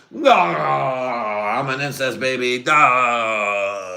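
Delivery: long, high cry of horror; Luke's mock wail. Luke learns the truth, drawn-out mock wail. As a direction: wail it out, hammed-up despair. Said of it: "that's what Luke could have been".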